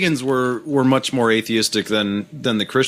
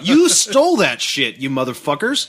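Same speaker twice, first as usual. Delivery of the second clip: angry rant; 6 semitones higher than usual. Real, not acted. Ranting at Christians.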